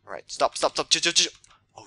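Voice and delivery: ordinary talk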